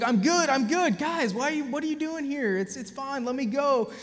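high, dismissive voice, brushing off help; dazed patient. Retold or imitated. A high-voiced, dismissive patient telling the medics to leave him. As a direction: wave them off in a high, careless voice. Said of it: "And he's like".

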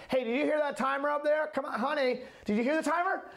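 high, pushy, impatient calling; annoyed husband nagging up the stairs. Retold or imitated. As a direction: nag her about the timer like the old irritated me. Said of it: "in the past, I would have been like".

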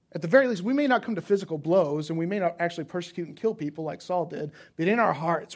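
loud pulpit push; full-voiced sermon emphasis; forceful preaching. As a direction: lean into the crowd with conviction. Preacher raising voice to congregation.

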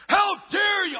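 high, loud indignant shout; mock outraged bystander yelling; outraged cry. Angry bystanders at crash scene.

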